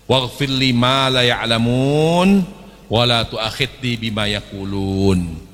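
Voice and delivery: slow, drawn-out Arabic prayer; slow teaching recital; slow recitation. Shown as a demonstration. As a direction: recite carefully for the listeners to follow.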